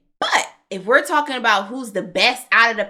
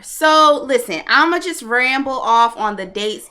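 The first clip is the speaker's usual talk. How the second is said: ordinary talk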